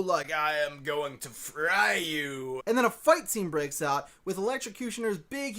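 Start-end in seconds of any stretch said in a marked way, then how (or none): 0.3-2.6 slow, low-key menacing villain line, lightly voiced; mock the villain's cool threat, drawn out. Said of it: "some kind of cool".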